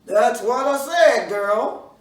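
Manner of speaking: loud, slow call back from far off; answer from afar. Acted. Bruh Man calls back to his daughter, loud and slow. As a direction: shout back across the field. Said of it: "The answer came back".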